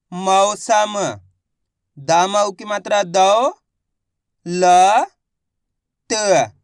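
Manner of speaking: loud, slow, syllable-by-syllable reading drill; a teacher sounding out Hindi words letter by letter. Shown as a demonstration. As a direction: drill each letter aloud for the class. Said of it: "आगे देखिए".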